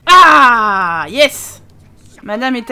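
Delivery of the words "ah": a loud mock scream after announcing it; announced mock yell. Gamer fakes a scream at the game, loud. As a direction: belt out a fake scream for fun. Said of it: "je vais hurler, je vais hurler".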